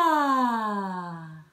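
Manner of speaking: sliding yawning sigh. Shown as a demonstration. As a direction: sigh it out like a yawn. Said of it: "Let's do one more".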